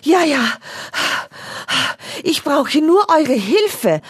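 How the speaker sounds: teddy still out of breath; loud panting. A narrator's reading. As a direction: gasp the words out. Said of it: "immer noch laut japsend".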